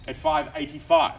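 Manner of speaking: high, loud bid call. Real, not acted. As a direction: ring it out across the room. Auctioneer calling out the bid.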